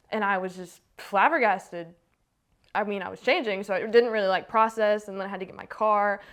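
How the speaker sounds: usual speaking voice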